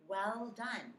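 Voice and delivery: ordinary delivery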